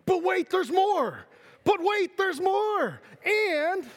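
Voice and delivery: high, slow TV pitchman voice; hammy late-night infomercial announcer. Pretend. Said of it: "This sounds like an infomercial".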